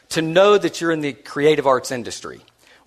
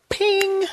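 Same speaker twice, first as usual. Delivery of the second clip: high bright ping sound; a sudden squeak of realization; mental ping. Retold or imitated. Recalling the deadline during dessert, a high ping. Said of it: "I went".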